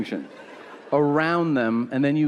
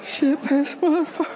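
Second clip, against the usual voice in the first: put-on very high sing-song child voice; kid repeating curses; 14 semitones higher than usual. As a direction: parrot the swearing like a tiny kid. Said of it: "and then they'll be like".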